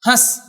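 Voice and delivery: हंस as a short "hãs", no separate n; quick, short vowel. Shown as a demonstration. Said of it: "जल्दी से बोलेंगे".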